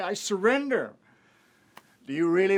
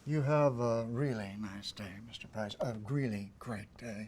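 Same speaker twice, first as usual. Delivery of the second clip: pointed slow farewell; 6 semitones lower, 7 dB quieter than usual. Acted.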